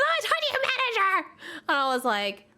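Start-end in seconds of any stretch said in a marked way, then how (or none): 0.0-1.3 very high, quick mocking screech; indignant customer. Customer refused a refund, mock indignant. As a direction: imitate an entitled customer in a huff. Acted customer demanding the manager.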